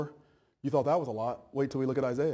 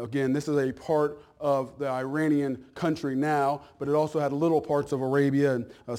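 ordinary talk

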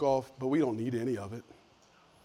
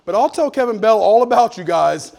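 higher, louder promise; 8 semitones higher, 14 dB louder than usual. Retold or imitated. The preacher promising his relatives help, loud and high. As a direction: call it out like good news.

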